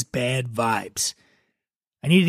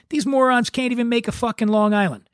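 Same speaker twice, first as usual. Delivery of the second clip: much higher, exasperated complaint; indignant guest; 7 semitones higher than usual. Acted. Guest griping to a stranger about bartenders, indignant. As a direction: complain in a high, put-on indignant voice.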